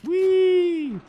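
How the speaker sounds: high, loud delighted squeal; gleeful whee. Real, not acted. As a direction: squeal with glee. Gleeful cry while falling.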